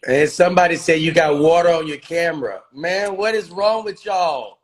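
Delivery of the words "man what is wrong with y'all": slow, higher, drawn-out what is wrong with y'all; mock-scolding the audience, drawn out; exasperated disbelief. Real, not acted. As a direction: shake your head at the chat, slowly. Scolding viewers over camera comment.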